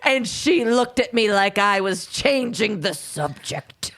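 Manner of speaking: slow, drawn-out aged lament; withering old man. Acted. Podcaster voicing the suspect on his wife, slow withering old man. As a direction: stretch it like a dramatic old man. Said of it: "He's withering away in front of them".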